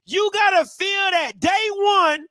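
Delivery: high-pitched, forceful hype talk; rally the fans for day one; fired-up hype. Real, not acted. Hypes up day-one energy over song.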